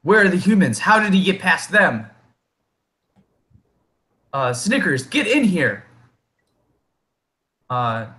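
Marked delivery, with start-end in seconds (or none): 0.0-2.1 high-pitched, questioning kobold voice; third kobold. Acted. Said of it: "that third voice is like". 4.5-5.8 high-pitched kobold summons; third kobold. Acted. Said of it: "that third voice is like".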